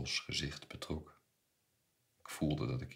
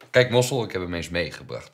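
hearty announcement; 3 semitones higher, 14 dB louder than usual. A narrator's reading. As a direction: announce it boomingly to an old acquaintance. Uncle presents nephew to Mossel. (luid en joviaal aankondigen)

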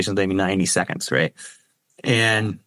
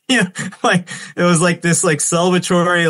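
laughing; 7 semitones higher than usual. Real, not acted. Laughing memory of celebration.

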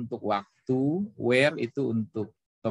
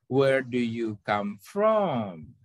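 drawn-out question ending in a long falling FROM; stress on FROM; much slower than usual. Shown as a demonstration. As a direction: hold back, then lean on FROM and glide down. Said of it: "Tetap turunnya".

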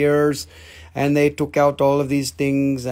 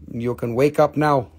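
ordinary delivery

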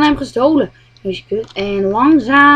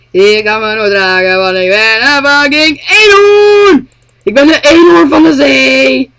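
proud unicorn; 3 semitones higher, 6 dB louder than usual. Pretend. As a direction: announce yourself as a mighty unicorn.

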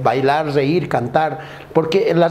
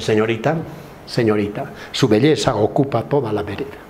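piropo más grave y seductor; lánzale el piropo galante en voz grave; 6 semitones lower than usual. Acted. Piropo galante a chica linda. (piropo grave y galante a una chica linda)

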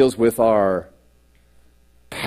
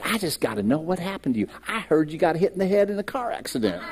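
a hint of a quick, softer, laughing classmate; teasing classmate; 5 semitones higher, 6 dB quieter, faster than usual. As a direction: lightly voice a curious old classmate. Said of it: "she said".

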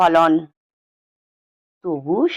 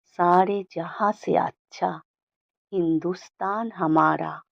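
unconscious murmur; 7 dB quieter than usual. A narrator's reading. Father's anthem line slips out unbidden, softly to himself. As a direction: let it slip out quietly, as if unawares. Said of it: "অবচেতনে মুখ থেকে বেরিয়ে আসে".

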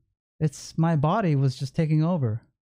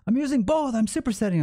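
high-pitched mock angry retort; imitated yell, raised in pitch rather than volume; retold yelling; 7 semitones higher, faster than usual. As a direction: mimic an irritated man, voice jumping up. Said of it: "He started yelling me at again".

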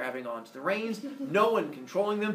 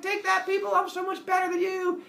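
high-pitched bragging holler; hammed-up triumphant shouting; gloating scream; 3 dB louder than usual. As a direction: taunt the crowd far below, voice way up. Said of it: "Phaethon is screaming down to everyone".

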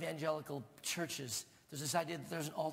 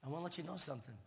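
ordinary talk

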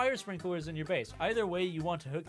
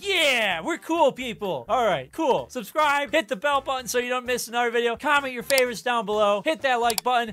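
hyped outro; 5 semitones higher, 7 dB louder than usual. Real, not acted.

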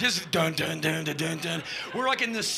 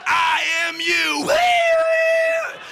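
very high, loud whooping; hammed-up stage screams; wild rock yells; 8 dB louder, much slower than usual. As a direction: howl like a frenzied rocker.

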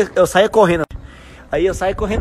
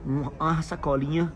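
softer, fast-paced confiding talk; nostalgic lecture; 6 semitones lower, 8 dB quieter than usual. Acted. Papa telling his daughter how simple things were, soft and quick. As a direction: lean in and share an old lesson.

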